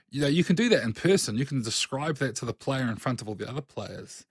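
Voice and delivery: ordinary talk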